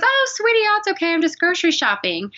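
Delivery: a kindly grandma soothing a nervous caller; sweet grandmotherly woman. Retold or imitated. Kindly older woman answering her phone at night, voiced high and sweet. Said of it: "She goes".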